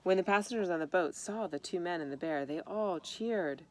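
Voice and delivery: plain reading voice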